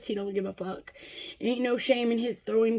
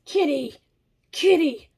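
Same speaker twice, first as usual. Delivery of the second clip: real sharp call to a pet, higher and louder; 5 semitones higher, 6 dB louder than usual. Real, not acted. Breaks off her story at the cat, calling out.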